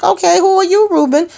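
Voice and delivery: high, sharp questioning; suspicious surprise. Pretend. Recapper grilling the stranger Ruben, high and suspicious.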